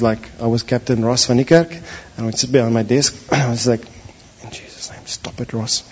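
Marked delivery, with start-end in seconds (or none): 4.4-5.8 whispered self-command under his breath. Retold or imitated. Alone at his desk, rebuking his own thought in a whisper. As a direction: hiss it to yourself so nobody hears. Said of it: "and it's very quiet".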